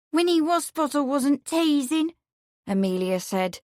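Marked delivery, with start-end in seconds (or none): 0.1-2.1 higher, tearful maid's voice; young woman, teary. A narrator's reading. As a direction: voice her as a hurt young maid. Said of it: "wiping her eyes".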